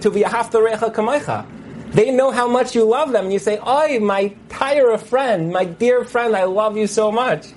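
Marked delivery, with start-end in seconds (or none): 3.7-7.5 high, slow, affectionate appeal; loving rebuke. Shown as a demonstration. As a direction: plead with a dear friend out of love. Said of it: "I say this out of abundance of love".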